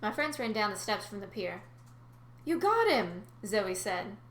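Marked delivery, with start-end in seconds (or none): 2.4-3.3 amazed. Acted. Zoe amazed at capture.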